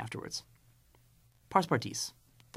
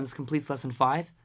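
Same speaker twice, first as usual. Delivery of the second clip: ordinary talk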